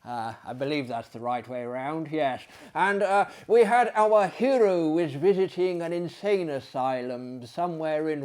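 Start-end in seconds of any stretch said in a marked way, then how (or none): none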